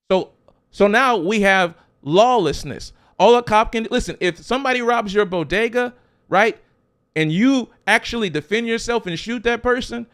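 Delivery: fired-up pulpit preaching; full-force preaching. Acted. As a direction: preach it hard to the congregation. Preaching about robbed bodega owners.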